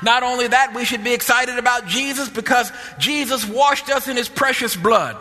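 rally the church with rising fervent chant; full-force preaching. Acted. Preacher builds to full fervor.

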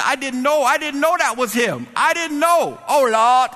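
very high, building to a loud wail; hammed-up frantic excuse; panicked pleading. Clerk pleading ignorance to supervisor, comic panic.

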